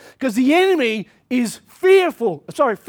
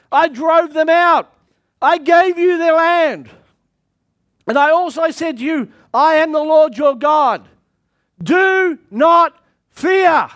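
preaching at full force; 2 semitones higher, 4 dB louder than usual. Acted. Preacher voicing God, building.

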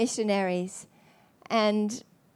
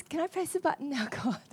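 laughing plea; 4 semitones higher, 8 dB quieter than usual. Retold or imitated. Playful question to God.

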